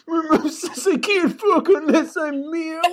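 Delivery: put-on high lisping teen, laughing through it; lispy teenager voice. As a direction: lisp it like a whiny teen. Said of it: "that lispy, like, teenager voice".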